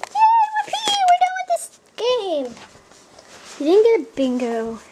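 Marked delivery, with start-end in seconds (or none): 0.1-2.5 high, squealing fake celebration; mock cheer. Kid to mommy about a faked bingo, squealing mock cheer. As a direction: overplay the victory.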